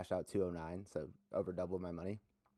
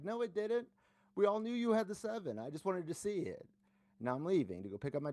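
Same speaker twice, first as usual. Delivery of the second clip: squeaky sing-song put-down, much higher; high mocking retort; 11 semitones higher than usual. Telling the poker opponent everyone knew his seven, mocking high voice. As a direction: lord it over him.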